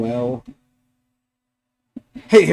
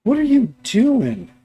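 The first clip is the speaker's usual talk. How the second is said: high, drawn-out "what are you up to"; slow, higher-pitched challenge to a pet; exasperated pet-owner question; scolding the dog; 10 semitones higher, slower than usual. Real, not acted. Calls out to misbehaving dog.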